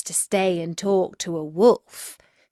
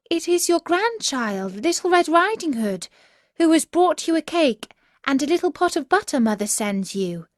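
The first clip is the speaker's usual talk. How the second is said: put-on much higher, sweet child voice; little girl's voice; 8 semitones higher than usual. Girl at grandmother's door, high child voice. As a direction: speak up like a trusting little girl.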